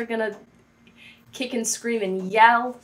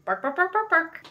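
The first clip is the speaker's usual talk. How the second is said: mock barking; 5 semitones higher than usual. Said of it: "She loved barking".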